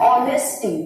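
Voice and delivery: "ऑनेस्टी" opening on the vowel, no H; silent-H pronunciation. Shown as a demonstration. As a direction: carefully demonstrate "ऑनेस्टी" without the H. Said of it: "तो इसका pronunciation क्या है".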